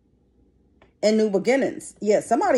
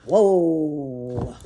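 awed whoa; 6 semitones lower, 4 dB quieter, much slower than usual. Real, not acted. Stunned by a grim reading.

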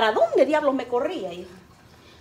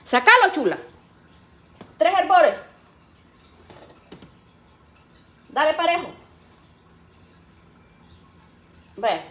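mother's instructions; 4 semitones higher, 5 dB louder than usual. Retold or imitated. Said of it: "Así decía mi mamá".

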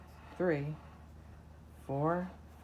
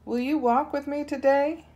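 higher, eager little lion cub calling out; a small cub trying to sound big; roaring lion cub; 9 semitones higher, 4 dB louder, faster than usual. Acted. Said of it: "He roars by the lake".

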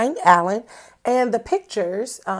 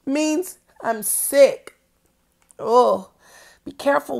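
usual speaking voice

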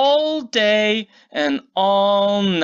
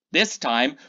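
ordinary delivery, nothing marked

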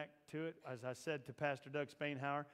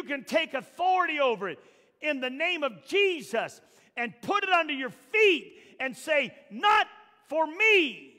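higher and louder, pushing the crowd; preaching at full force; 9 semitones higher, 14 dB louder than usual. Acted. Preacher rousing the congregation, full force. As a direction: preach it, rising.

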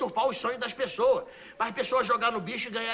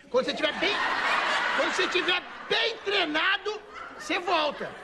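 raised pitch, stretched-out emphasis; emphatic instruction; 7 semitones higher than usual. Acted. Madam sending the índio off to train, high and emphatic. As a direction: lean hard on "bem" in "bem treinado", high and held.

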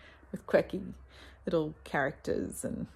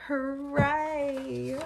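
cheer; 7 semitones higher than usual. Real, not acted. Shelving new books.

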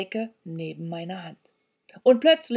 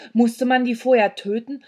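ordinary delivery

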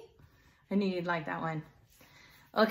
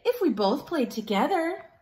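hopeful Trevor; 6 semitones higher than usual. Acted. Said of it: "Trevor said to Pig".

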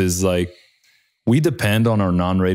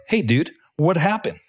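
high, startled what happened; alarmed question; 10 semitones higher than usual. Retold or imitated. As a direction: retell the shock of finding the hole. Pilot finds hole in aircraft.

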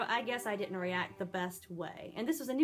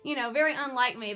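ordinary delivery

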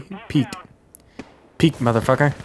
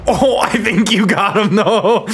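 laughing, pitched up; 9 semitones higher, 5 dB louder, slower than usual. Real, not acted. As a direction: talk through giggles. Teammate gets the kill.

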